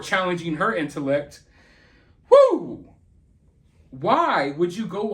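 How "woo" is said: sharp exclaimed "woo"; high, loud whoop. Real, not acted. Commentator reacting to a mother's jab at her kid, exclaiming.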